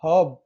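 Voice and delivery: हब said loudly as "hub" for herb; er as short vowel. Shown as a demonstration. Teacher demonstrating what the tongue produces, loud clear हब. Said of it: "क्या pronounce कर रहे हैं".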